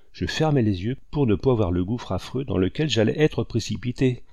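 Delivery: ordinary delivery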